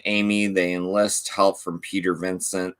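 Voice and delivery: usual speaking voice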